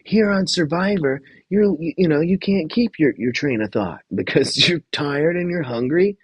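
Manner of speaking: high-pitched mock TV host; put-on host voice, pitched well up; Jeff. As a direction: imitate a host seizing on his point. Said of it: "And then Jeff goes".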